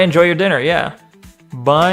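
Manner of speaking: ordinary delivery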